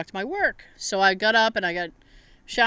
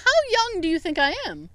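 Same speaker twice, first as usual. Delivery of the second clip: high-pitched mock offended question; retold indignation; 9 semitones higher than usual. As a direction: question it in playful disbelief, pitched up. Retells indignant retort to boss.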